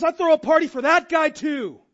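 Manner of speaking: high, loud, festive invitation; joyful welcome. Pretend. God welcoming a sinner home, joyful and loud. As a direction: throw the doors open and cheer. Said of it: "And God goes".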